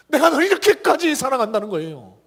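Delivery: 힘주어 외치듯; emphatic. Shown as a demonstration. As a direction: proclaim 내가 널 이렇게까지 사랑한다는 거예요 with force. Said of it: "웅변하고 있는 게".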